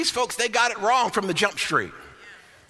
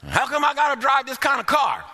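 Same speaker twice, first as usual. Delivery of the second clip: mock grumbling; 7 semitones higher than usual.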